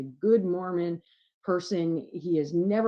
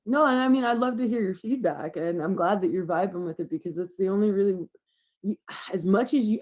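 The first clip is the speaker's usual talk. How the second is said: normal talking voice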